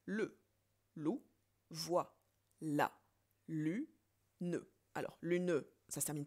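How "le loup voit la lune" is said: one syllable at a time with pauses; counting syllables. Shown as a demonstration. Teacher counting syllables one by one for students. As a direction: tap out each syllable separately. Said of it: "Combien ai-je de syllabes?".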